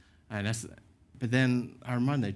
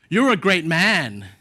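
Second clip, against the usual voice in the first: slow, loud, raised jibe; mock praise; 4 semitones higher, 7 dB louder, slower than usual. Stranger taunting the man as he walks off, slow loud mock praise.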